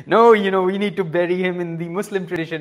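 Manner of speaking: loud, quick, insistent objection; mock argument. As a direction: argue the point like a squabbling disciple. Disciples quarrel over funeral rites.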